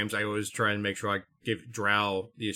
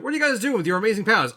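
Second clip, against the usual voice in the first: high, loud, rapid-fire mock questioner; 11 semitones higher, 7 dB louder, much faster than usual. Someone quizzing friends about their superpowers, high excited mock question. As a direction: play an incredulous interrogator, fast and pitched up.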